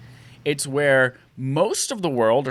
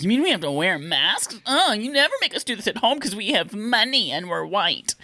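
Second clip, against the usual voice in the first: put-on high, whiny rich kid; bratty kid voice; 7 semitones higher than usual. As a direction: whine it like a spoiled kid. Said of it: "the kids even were like".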